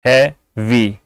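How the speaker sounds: Heavy broken in two, he, pause, vi; heavy in two separate beats; split into syllables. Shown as a demonstration. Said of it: "इसमें दो syllable हैं".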